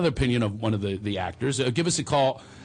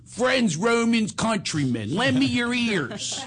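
higher mock Philadelphia accent, drawn out; a hint of a Philadelphia accent on a famous speech; Philly accent; 7 semitones higher, much slower than usual. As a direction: recite Shakespeare like a Philly guy. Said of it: "a Philadelphian going".

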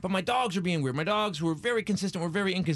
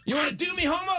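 mock tough guy; 11 semitones higher than usual. Stranger's laughing retort.

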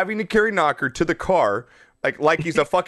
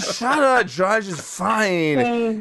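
very drawn-out, slurry dismissal; drunk dad; 4 semitones higher, much slower than usual. Retold or imitated. Son mimics drunk dad brushing him off, drawling. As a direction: wave it off like a tipsy father.

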